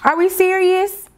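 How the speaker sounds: high, incredulous questions; can't believe the gift; disbelief. Real, not acted. Vlogger to viewers, high incredulous disbelief.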